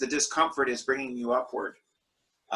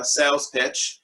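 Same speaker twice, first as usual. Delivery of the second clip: ordinary talk, nothing marked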